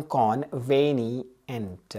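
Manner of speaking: "कॉन्वेनिएंट" slowly as con, veni, ent; split for memorising. Shown as a demonstration. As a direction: repeat "कॉन्वेनिएंट" in three drawn-out parts. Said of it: "repeat कर देता हूँ".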